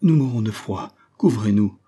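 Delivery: mildly quavering plea of freezing men. A narrator's reading. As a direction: beg for cover, a touch tremulous.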